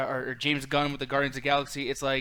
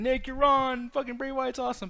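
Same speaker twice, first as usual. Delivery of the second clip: much higher, whiny imitation of an annoyed fan; mock chat viewer; 10 semitones higher than usual. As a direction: parody a fan shouting back at him. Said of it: "the chat's probably gonna respond here saying".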